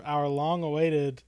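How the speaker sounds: normal talking voice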